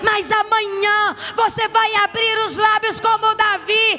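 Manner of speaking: high, loud proclaiming; triumphant preaching. Acted. Pastor proclaiming David's praise to the congregation, loud and high. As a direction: shout God's victory over the church.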